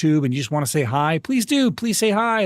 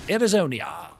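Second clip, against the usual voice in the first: [name]; 4 dB quieter than usual. Shown as a demonstration. Streamer shows off the impression, [name] voice. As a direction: savour the place name pompously. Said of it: "doing my [name] impression".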